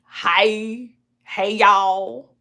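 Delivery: a light Southern drawl on "Hi, hey, y'all"; a touch of Southern country twang; Southern twang greeting. Shown as a demonstration. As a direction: show off a small-town Southern "hey, y'all". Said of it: "you will hear me say".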